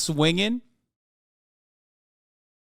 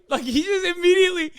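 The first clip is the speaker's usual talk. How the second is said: laughing; 11 semitones higher, faster than usual. Real, not acted. Laughing at the clip.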